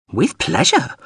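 high-pitched small robot voice; prim little drone. Acted. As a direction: answer readily in a high little voice. Drone answering the order to leave.

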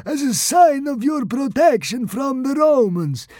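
high, slow, loud blubbering consul; sobbing plea. Acted. Consul sobbing as he offers his shield. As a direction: beg through sobs. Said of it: "he sobbed".

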